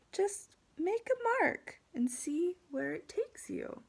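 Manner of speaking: high, lilting teacher voice; encouraging gentle teacher. Acted. Teacher to stuck pupil, gentle encouragement. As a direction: coax the pupil to have a go. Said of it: "the teacher is being very calm and gentle".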